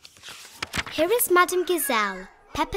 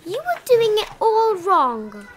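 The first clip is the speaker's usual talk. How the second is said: much higher and louder, scolding; bossy snort; 8 semitones higher, 7 dB louder than usual. A narrator's reading. Big sister correcting George's painting, higher and louder. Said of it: "says Peppa".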